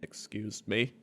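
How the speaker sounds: timid question. Retold or imitated. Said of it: "He's like".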